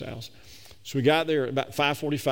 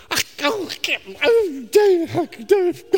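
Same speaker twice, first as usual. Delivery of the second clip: much higher, louder, broken exclamations ending in a laugh; wife reeling at her husband's lie, shouting high; distraught shout; 8 dB louder than usual. Retold or imitated. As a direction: stammer out the shock as if it can't be real. Wife's continued shocked outburst.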